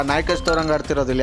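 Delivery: usual speaking voice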